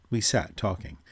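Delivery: ordinary delivery, nothing marked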